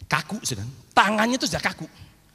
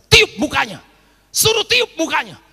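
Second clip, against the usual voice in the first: urgent divine command; 8 semitones higher, 6 dB louder than usual. Retold or imitated. God's voice instructing him.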